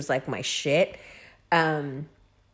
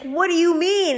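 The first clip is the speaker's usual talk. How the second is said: mom; 11 semitones higher than usual. Retold or imitated. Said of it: "she was like".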